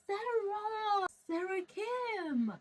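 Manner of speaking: high, drawn-out, swooning "Sarah Kim"; awed gasp of the name. Real, not acted. Vlogger swooning over her favourite character Sarah Kim, awed. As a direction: sigh her name like a starstruck fan.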